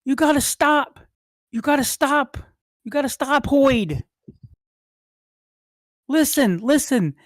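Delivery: high, loud, urgent yell; Willem yelling. Acted. Older brother calls after the fleeing boy, urgent yelling. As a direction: call after someone running away, high-pitched. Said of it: "you hear Willem behind you yelling".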